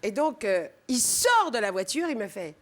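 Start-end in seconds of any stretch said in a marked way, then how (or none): none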